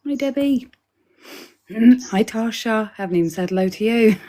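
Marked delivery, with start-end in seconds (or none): none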